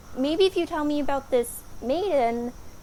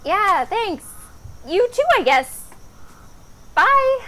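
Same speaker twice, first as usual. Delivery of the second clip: raised, higher parting call; awkward loud farewell; 5 semitones higher, 7 dB louder, slower than usual. Acted. Maiden sees off the odd prince, awkwardly calling out. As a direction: call goodbye after someone leaving.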